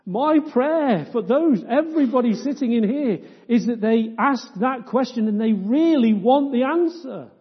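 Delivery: loud, ringing preacher's appeal; full-force preaching. Real, not acted. Preacher praying aloud for the congregation, fervent. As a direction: lift it up and pour it out over the congregation.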